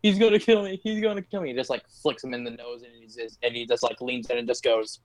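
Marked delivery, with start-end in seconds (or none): 0.0-1.5 higher-pitched pretend panic; mock freaking out. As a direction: act out a panicked man babbling. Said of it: "who's just freaking out".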